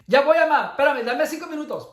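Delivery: high, loud, sing-song mock obedience in Spanish; mock servile reply. Mimicking the customer's meek obedience, in a high mocking voice. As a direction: play the meek servant, sarcastically.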